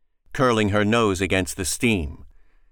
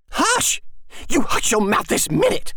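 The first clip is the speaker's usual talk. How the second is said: crying fury. Acted. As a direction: scream it through tears. Mother cries out, strikes.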